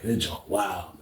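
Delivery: low, barely voiced aside; hushed disbelief. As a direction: mutter it almost under your breath. Muttered reaction while secretly watching.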